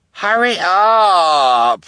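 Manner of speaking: high, loud, long call down the hall. Acted. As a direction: call from far down the hallway. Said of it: "Esme called from down the hallway".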